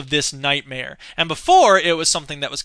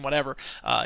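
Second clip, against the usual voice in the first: ordinary delivery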